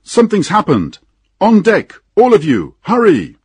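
urgent high, loud alarm call; sudden shout. A narrator's reading. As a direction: shout everyone up on deck. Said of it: "he heard a sudden shout".

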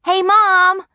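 high, loud excited call; yelling. A narrator's reading. Margaret runs into the studio, excited yell. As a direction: yell out for Mom. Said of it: "I yelled".

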